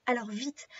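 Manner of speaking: usual speaking voice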